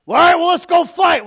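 loud, high fighting holler; mock-eager yell for a fight; acted challenge. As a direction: shout it like taking up a dare. Acts out taking up a challenge.

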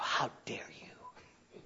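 whispered, slow mock outrage; mock offended whisper. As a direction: hiss it back in fake offense. Mock-offended reply to mom.

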